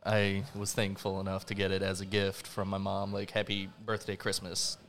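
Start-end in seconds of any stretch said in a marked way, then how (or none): none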